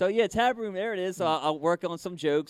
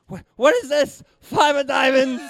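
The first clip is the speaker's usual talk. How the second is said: high, loud hammed-up shock; mock amazement; 8 semitones higher, 9 dB louder than usual. Spectator finds his card in his pocket, hammed-up amazed shriek. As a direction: squeal the discovery, stretching the final words.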